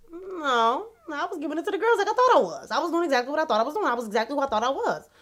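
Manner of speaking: high, rapid-fire insistence; insistent self-vindication. Retold or imitated. Answering her own doubt after the video, insistent.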